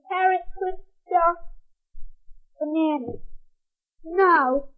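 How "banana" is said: plain reading voice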